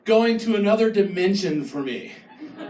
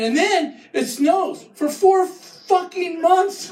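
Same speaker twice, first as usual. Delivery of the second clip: usual speaking voice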